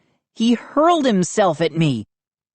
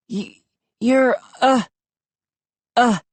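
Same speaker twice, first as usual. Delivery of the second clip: weak and faint; 6 semitones higher than usual. A narrator's reading. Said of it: "coughed weakly".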